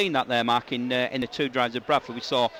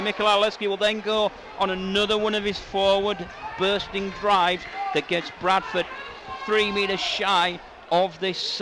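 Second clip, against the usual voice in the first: live play-by-play; 4 semitones higher than usual. Acted. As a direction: call the attack near the line with building urgency. Live call of forward drives.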